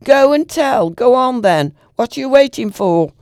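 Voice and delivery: loud, high defiant shout from the gate. A narrator's reading. Girl shouting defiantly at her grandfather from the gate. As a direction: yell it at him, daring him to go. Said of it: "the girl shouted".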